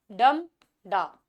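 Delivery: "डम डा" strong then weak, split by a pause; trochaic stress. Shown as a demonstration. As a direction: hit "डम", pause, let "डा" fall. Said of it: "यानी stressed और फिर unstressed".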